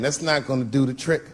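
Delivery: ordinary talk, nothing marked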